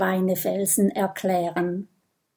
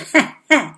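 snake laugh; 5 semitones higher, 6 dB louder than usual. Acted.

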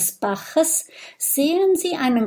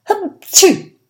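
loud high acted sneeze; spoken sneeze that bursts out high; sudden sneeze; 11 semitones higher, 8 dB louder than usual. A narrator's reading. Porcupine sneezing suddenly mid-conversation, acted sneeze. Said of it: "muss plötzlich niesen".

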